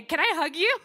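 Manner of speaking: high, loud, obnoxiously American gushing. Retold or imitated. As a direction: blurt it like an over-eager American tourist. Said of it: "obnoxious American way".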